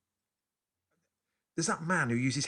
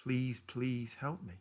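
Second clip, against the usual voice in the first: slow weary plea; tired voice; 2 semitones higher, 4 dB quieter, much slower than usual. Retold or imitated. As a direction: drag it out, worn out. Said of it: "this sort of tired voice".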